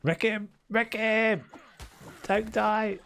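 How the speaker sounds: high shouting encouragement; excited cheering. Real, not acted. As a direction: egg them on in battle. Cheering on fight in game.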